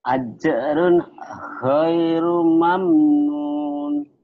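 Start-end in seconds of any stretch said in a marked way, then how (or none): none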